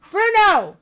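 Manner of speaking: very loud, high command shout; strong voice. Real, not acted. Owner yelling at her puppy Bruno, strong command voice. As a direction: yell his name with full authority. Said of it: "I have to do a strong voice".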